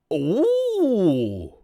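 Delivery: long high loud "ooh", sliding down; startled loud "oh". Real, not acted. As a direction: let out a big rising-then-falling ooh. Startled reaction to the set.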